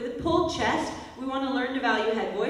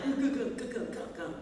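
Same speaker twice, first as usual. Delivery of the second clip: stalling car noise; 6 dB quieter than usual. Retold or imitated. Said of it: "your car's like".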